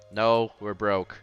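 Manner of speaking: ordinary delivery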